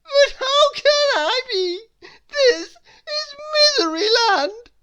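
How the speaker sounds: high, loud tearful wail; crying king. A narrator's reading. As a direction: keep wailing in despair. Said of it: "cried the king".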